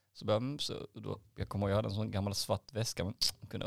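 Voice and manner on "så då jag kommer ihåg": ordinary delivery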